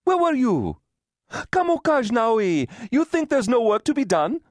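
high-pitched, cross telling-off; snapping scold. A narrator's reading. Mother impatient with daughter over undone work, snapping. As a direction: scold sharply, voice pitched well up. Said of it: "she snapped".

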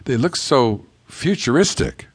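usual speaking voice

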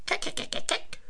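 whispered keck keck of monkeys; quiet chattering monkey calls. A narrator's reading. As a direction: monkeys arriving, soft chattering. Haben sie gemacht.

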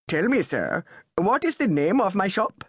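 put-on clipped English public school accent, irritable; a shopkeeper with a posh public school accent, needling. A narrator's reading. Anwar challenging Charles, in a public school accent. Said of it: "said in his public school accent".

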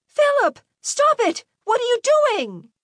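very high, frantic cry to stop; shocked wife shrieking at her husband; alarmed mother. A narrator's reading. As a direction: cry out to halt the violence. Mother rushes in on violence.

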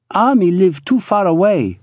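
put-on high accented woman's voice; high-pitched matter of complaint; voiced Pearl. A narrator's reading. Pearl answering Ruby from the kitchen, high voice.

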